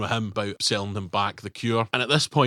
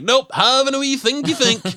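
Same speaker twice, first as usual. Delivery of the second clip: much higher, louder silly voice; mock dawdling hero; 13 semitones higher, 6 dB louder than usual. Hero dawdles as danger grows, high silly chirp. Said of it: "he's just like".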